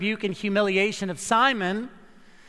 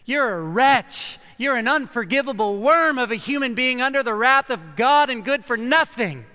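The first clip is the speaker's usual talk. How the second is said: hammed-up legalistic scolding; harsh condemnation; 5 semitones higher, 7 dB louder than usual. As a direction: hurl the accusation at her like a Pharisee. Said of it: "which screamed at her for attention".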